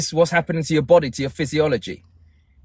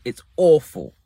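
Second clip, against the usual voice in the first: ordinary talk, nothing marked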